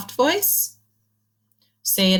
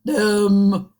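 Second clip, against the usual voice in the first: loud, goofy put-on voice; silly voice; 5 dB louder than usual. Shown as a demonstration. Teacher showing children a silly voice, goofy. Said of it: "Say it in a silly voice".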